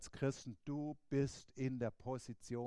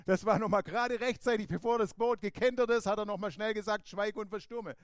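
relieved disciples; 5 semitones higher, 8 dB louder than usual. Retold or imitated. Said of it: "so haben sich wahrscheinlich die Jünger gefühlt".

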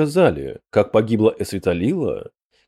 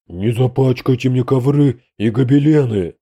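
lightly voiced grumbling boom from above; a touch of a fussy, droning grouch; grumpy drone; 2 semitones higher, 3 dB louder than usual. A narrator's reading. Demon fussing over his carpets from above, grumpy drone. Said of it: "брюзгливо прогудел сверху Гламгальдрик".

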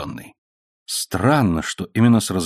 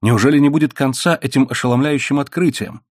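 usual speaking voice

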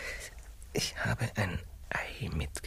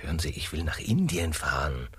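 ordinary delivery

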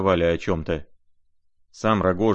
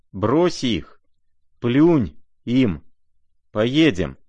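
louder, emphatic narrated exhortation; urgent urging; 4 semitones higher, 5 dB louder, much slower than usual. A narrator's reading. Rogozhin to the prince, urging him to leave. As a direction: urge him on in short bursts.

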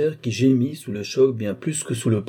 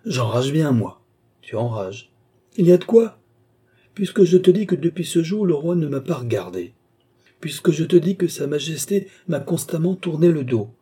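much higher and louder, fuming; the duke vents his fury about the king, much higher and louder; exasperated outburst; 5 semitones higher, 3 dB louder than usual. Acted. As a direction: let the rage burst out at the baron.

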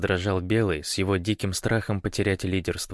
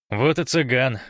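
raised, louder offhand call; tossed over the shoulder; 5 semitones higher, 5 dB louder than usual. A narrator's reading. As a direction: toss it back over your shoulder. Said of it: "бросил через плечо Гош". (небрежно бросить через плечо)

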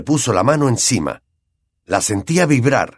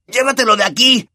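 bellowing; 13 semitones higher than usual. Acted. Ron yelling at Hermione to remove Crookshanks, angry. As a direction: roar it out. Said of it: "bramó Ron".

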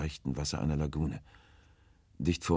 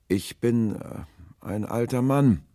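put-on high, loud, drawn-out old man's call; old stranger calling; 8 semitones higher, 8 dB louder, slower than usual. A narrator's reading. Old man answers from his boat in the dark, calling hesitantly. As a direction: call hesitantly across the water, pitched up.

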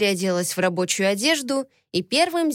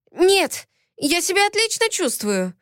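flustered, higher denial; embarrassed; 9 semitones higher than usual. A narrator's reading. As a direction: blurt it out, blushing. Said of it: "засмущалась я".

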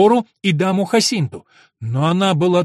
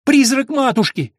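high, loud cry of fright; terrified cry; 10 semitones higher, 7 dB louder than usual. Acted. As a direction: blurt it out in horror. Girl cries out about ghost. (испуганный вскрик)

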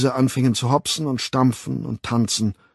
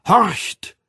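sudden high, loud call; ringing, high-pitched order to listen; trumpeted command; 10 semitones higher, 6 dB louder than usual. A narrator's reading. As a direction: trumpet it out like a herald. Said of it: "posaunte die Stimme".

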